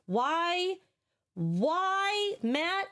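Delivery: high exasperated whine; exasperated why. Real, not acted. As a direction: plead in disbelief. Exasperated at chat's choice.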